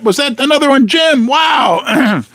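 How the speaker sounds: high, surprised exclamation. Real, not acted. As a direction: caught off guard, calling out. Surprised at another super chat.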